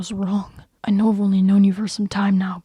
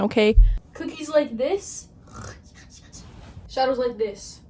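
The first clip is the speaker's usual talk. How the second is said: ordinary talk, nothing marked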